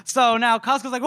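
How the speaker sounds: ordinary talk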